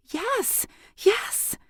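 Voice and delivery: high, breathless eager yes. A narrator's reading. Girl eagerly agreeing to learn from the priestess, excited. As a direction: blurt it out, thrilled. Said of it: "in her impatience and her excitement".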